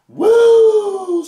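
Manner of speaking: high, loud celebratory "woo"; loud whoop. Real, not acted. Whoop after rating the episode.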